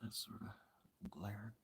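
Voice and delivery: slow unvoiced whisper, almost to herself. Real, not acted. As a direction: whisper it slowly so no one wakes. Quiet late-night talk in car.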